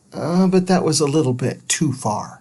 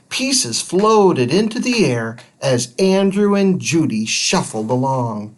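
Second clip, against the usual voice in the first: plain reading voice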